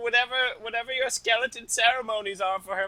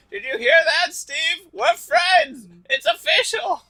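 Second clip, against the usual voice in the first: higher, louder, thrilled shout; excited announcement; 9 semitones higher, 7 dB louder than usual. Acted. Spad telling Steve they are friends, high excited shout. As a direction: burst with joy over the new friendship.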